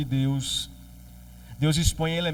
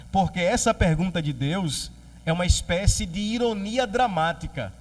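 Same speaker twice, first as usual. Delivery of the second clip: ordinary talk, nothing marked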